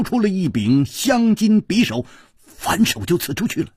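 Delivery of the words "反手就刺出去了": ordinary delivery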